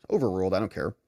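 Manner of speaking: low, soft mock judge; dismissive judge. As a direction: wave it away as the judge. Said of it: "Judge says".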